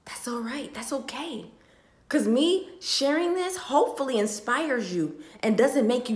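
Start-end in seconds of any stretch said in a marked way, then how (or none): 0.1-1.5 whisper. Real, not acted. Soothing herself mid-rant.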